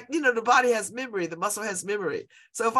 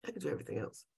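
much softer repeat to herself; softer echo; 9 semitones lower, 14 dB quieter, much faster than usual. Real, not acted. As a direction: say it again, softer, as if to yourself.

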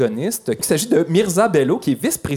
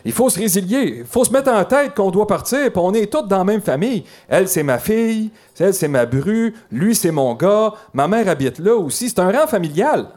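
higher-pitched voice of a local; resident's voice; 4 semitones higher than usual. Acted. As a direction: plead the family's case, pitched up. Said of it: "vous imaginez les deux scénarios".

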